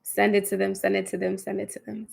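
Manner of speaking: fading meditation chant; guided meditation, fading. Acted. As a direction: let each repeat fall away.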